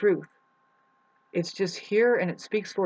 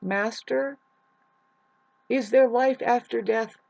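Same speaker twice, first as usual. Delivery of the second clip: earnest seeker; 6 semitones higher, 4 dB louder, slower than usual. Acted. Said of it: "I ruined my moment".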